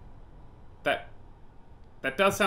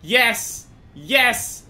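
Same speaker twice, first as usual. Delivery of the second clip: ordinary talk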